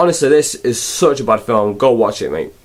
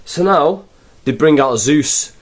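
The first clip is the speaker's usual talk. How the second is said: ordinary talk, nothing marked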